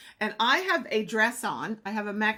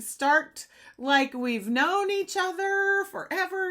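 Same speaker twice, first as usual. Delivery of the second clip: excited; 10 semitones higher, 5 dB louder, slower than usual. Real, not acted. Excited retelling.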